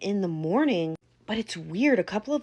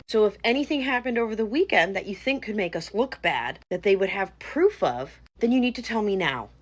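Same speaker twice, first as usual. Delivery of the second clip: ordinary talk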